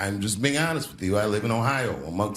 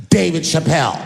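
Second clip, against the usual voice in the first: loud, raised mock call of a name; woman calling out; 4 semitones higher, 7 dB louder than usual. Mean lady calling to comic across parking lot, mock stern call. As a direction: imitate a stern stranger hailing someone. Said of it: "I hear a voice go ... Just like that".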